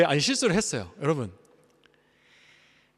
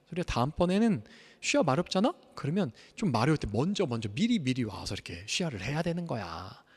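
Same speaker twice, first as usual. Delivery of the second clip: ordinary talk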